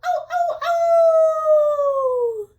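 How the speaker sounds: high, long wolf howling. Shown as a demonstration. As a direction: howl at the moon like a wolf. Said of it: "Can you howl like a wolf?".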